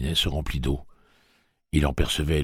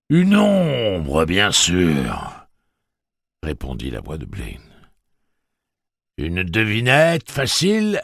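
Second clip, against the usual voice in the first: voix méditative de Blaine; 4 semitones higher, 7 dB louder, much slower than usual. A narrator's reading. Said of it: "un accent méditatif".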